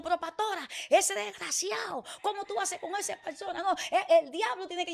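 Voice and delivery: mock indignation. La gente indignada con el muchacho. (imitando con indignación a la gente, más agudo)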